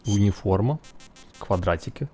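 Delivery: barely audible, slow; very quiet. Real, not acted. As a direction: murmur to yourself while sneaking. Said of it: "Включаю тактический режим".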